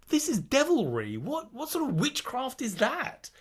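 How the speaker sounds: high mock horror at the chocolate; hammed-up scandalised disbelief; mock outrage. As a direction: play up the disbelief like it's sorcery.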